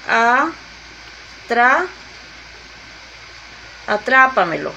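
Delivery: "atra-" broken into spaced syllables, then "atrápamelo" leaning on TRA; syllable split, stressed TRA. Shown as a demonstration. Teacher separating syllables for students, stress on TRA in "atrápamelo". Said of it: "Porque voy separando".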